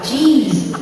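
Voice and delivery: put-on tossed-off Canadian accent, jaw locked; mock Canadian geez. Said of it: "she really has a Canadian accent".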